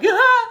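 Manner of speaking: short, high, loud yelp of fright; mock-terrified scream with a laugh; reenacted scream. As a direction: let out a startled shriek, half laughing. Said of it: "I was, like, screaming".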